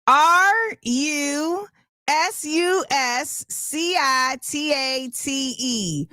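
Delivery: slow letter-by-letter spelling. Shown as a demonstration. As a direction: spell it out for the class.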